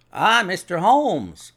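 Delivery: loud, high-lifted, drawn-out hearty hello; hearty greeting. A narrator's reading. Inspector greets Holmes as he arrives, hearty. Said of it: "said he, heartily".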